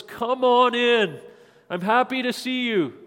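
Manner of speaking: put-on Jesus greeting children at an open door; welcoming host. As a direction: open the door wide with your voice.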